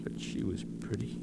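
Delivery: soft grieving whisper. Acted. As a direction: breathe it out in grief. Husband recalls dead wife.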